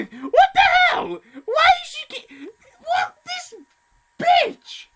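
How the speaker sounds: high-pitched, loud squealing outrage; squealed indignant outburst; outraged. Acted. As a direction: shriek in disbelief, half laughing. Outraged at the girl in game.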